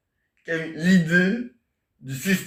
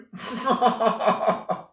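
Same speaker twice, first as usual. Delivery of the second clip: long, high-pitched rattling laugh; 6 semitones higher than usual. Real, not acted. Laughing after the Belgian accent bit, high cackling laugh. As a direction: laugh in a quick high string.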